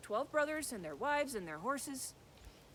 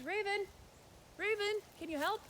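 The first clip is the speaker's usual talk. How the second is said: put-on higher girl's voice calling out; girl calling her raven friend, higher; 11 semitones higher than usual. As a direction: call up to the sky for the bird.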